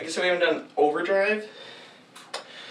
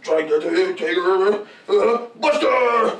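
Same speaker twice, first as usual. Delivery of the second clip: slow, loud shouted grab line; character battle cry; 5 dB louder, much slower than usual. Acted. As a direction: yell it mid-grab. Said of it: "He's like".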